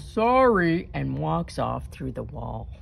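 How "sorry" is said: growled sorry. A narrator's reading. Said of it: "it growls".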